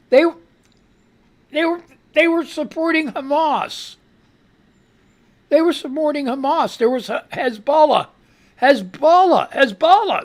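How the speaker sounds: worked-up repetition of Hezbollah; heated rant. Real, not acted. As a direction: hammer the point home. Ranting about protest flags.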